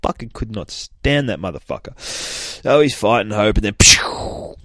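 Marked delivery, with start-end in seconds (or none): 3.8-4.5 whispered zap noise; mock laser pew. Reviewer voicing Cyclops's optic blast, breathy pew. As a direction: spit out a blaster sound.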